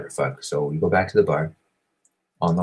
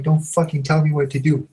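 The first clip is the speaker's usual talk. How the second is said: much higher, indignant outburst; re-enacted high-pitched angry retort; angry blow-up; 9 semitones higher than usual. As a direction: act out losing your temper at being bossed around. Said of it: "And it blew up".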